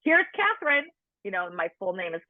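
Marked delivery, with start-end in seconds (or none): 0.0-0.9 high, sing-song teasing announcement; teasing nurses. Retold or imitated. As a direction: call it out like a playful nurse. Nurses joking about her big belly.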